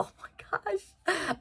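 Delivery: whispered "Oh my gosh" in awe. Real, not acted. Gushing over a character, awed whisper. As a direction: breathe "Oh my gosh" with no voice.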